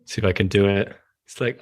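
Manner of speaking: ordinary delivery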